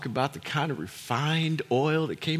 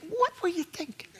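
amused disbelief; 10 semitones higher, 3 dB quieter than usual. Retold or imitated.

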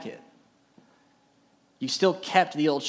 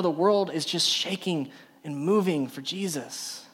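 ordinary delivery

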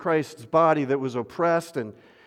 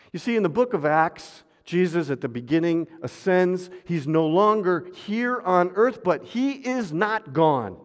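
normal talking voice

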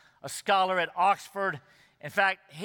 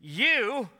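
"You" high, drawn out, then a pause; single out "You" as an accusation; emphatic YOU; 4 semitones higher than usual. Shown as a demonstration. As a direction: punch "You" and let it hang. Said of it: "similar contrast".

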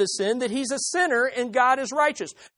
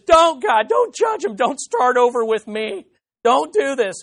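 pleading cry; 3 semitones higher, 5 dB louder than usual. Retold or imitated. Moses crying out to God not to judge, loud pleading. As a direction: beg God to hold back judgment.

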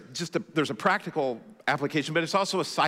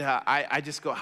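ordinary delivery

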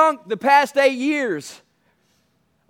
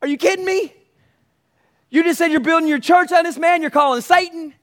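incredulous; 7 semitones higher than usual. Acted. Mock retort to Peter.

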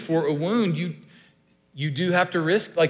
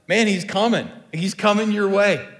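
higher, louder voice from the crowd; acted calling out; 5 semitones higher, 7 dB louder than usual. Someone in the crowd tells the blind man, calling out. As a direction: call the news over to him.

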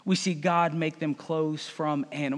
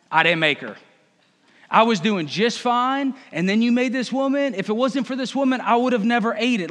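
louder, mostly higher blame-shifting complaint; Adam whining; 3 semitones higher, 3 dB louder than usual. Acted. Adam blaming God for the woman, loud whine. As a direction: whine like a sulky child caught out. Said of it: "The man replied".

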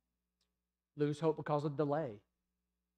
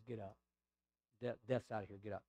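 very soft, hushed reassurance; soft whisper; 4 semitones lower, 9 dB quieter than usual. Retold or imitated. Jesus telling the child death is gone, hushed and gentle. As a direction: breathe it gently into her ear. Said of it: "And then he whispers into the ear of the child".

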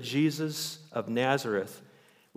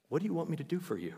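higher, softer and quick, like a gentle marvel; tender repeat; 5 semitones higher, 5 dB quieter, much faster than usual. Retold or imitated. Preacher savours Jesus' question, higher, softer, quick. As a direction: repeat it softly in wonder. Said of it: "What an amazing question".

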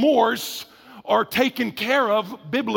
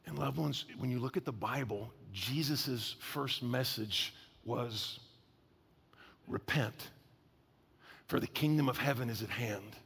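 hushed preaching; 9 semitones lower, 14 dB quieter than usual. Acted. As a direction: draw them close with a quiet solemn word.

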